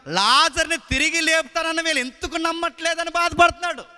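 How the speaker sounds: loud impassioned preaching; full-force preaching. Acted. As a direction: preach it out to the crowd.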